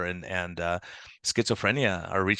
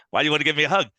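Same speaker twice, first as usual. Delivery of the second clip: surprised question; 5 semitones higher, 6 dB louder, much faster than usual. Retold or imitated. Retells his surprise at a hug.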